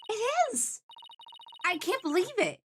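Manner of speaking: high-pitched, agitated disbelief; shocked outburst. Acted. Colleague hearing of murder at a loved aquarium, high shocked outburst. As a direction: exclaim it, pitch leaping up.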